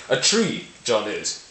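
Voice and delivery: slowly emphasise "tree", then drop on the rest; stress on TREE. Shown as a demonstration. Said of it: "really putting emphasis on".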